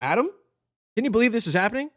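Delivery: thrilled voice on the phone; lightly voiced Adam. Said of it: "Adam Flayman through the phone".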